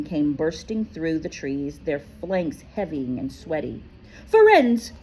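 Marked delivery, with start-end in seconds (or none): 4.3-4.9 a furious high shout of his name; thundered name. A narrator's reading. Bane yelling at Firenze, a thundering high shout. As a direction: bellow it across the clearing. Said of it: "Bane thundered".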